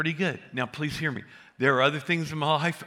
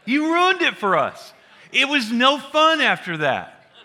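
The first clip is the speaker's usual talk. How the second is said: higher, louder and slow, a hammed-up whine; mock wail; 12 semitones higher, 9 dB louder, slower than usual. Daughters blaming dad for the spoiled tattoo, in a hammed-up loud whine. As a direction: replay their reproach bigger, stretched out.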